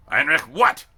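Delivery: loud, high demand; demanding bark. A narrator's reading. Sergeant demands the recruit add sir, loud. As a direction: snap it back at him.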